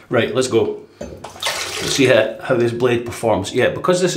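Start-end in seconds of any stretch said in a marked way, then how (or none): none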